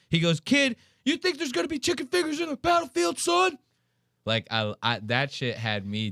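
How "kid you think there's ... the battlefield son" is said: high, barked drill-instructor voice; drill sergeant yell. Retold or imitated. Instructor scolds the kid for wanting chicken fingers, high mock bark. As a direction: play the tough coach scolding a kid.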